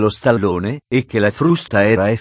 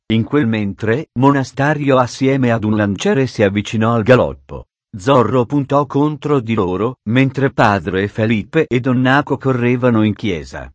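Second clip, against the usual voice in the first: ordinary delivery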